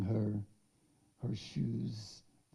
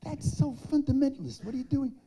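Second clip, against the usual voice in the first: much higher, half-whispered then exclaimed; incredulous scolding; 13 semitones higher, 8 dB louder than usual. Retold or imitated. Speaker chides Avital for her fundamentalist claim, incredulous. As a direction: protest in disbelief. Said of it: "I say".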